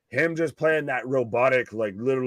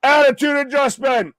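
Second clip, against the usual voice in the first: higher and louder, excited shout; big hype callout of the finisher; calling the move; 10 semitones higher, 7 dB louder than usual. Acted. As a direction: yell it like a ringside commentator.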